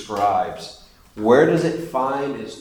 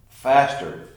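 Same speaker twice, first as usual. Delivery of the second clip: ordinary delivery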